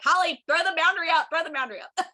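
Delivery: loud, high push to set a boundary; urging sister. Retold or imitated. To her people-pleasing sister, a loud high urging call. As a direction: urge your sister loudly to throw it up. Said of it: "I'm constantly being like".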